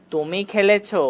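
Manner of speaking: ordinary talk, nothing marked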